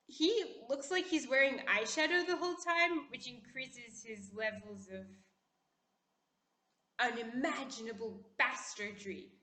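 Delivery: ordinary talk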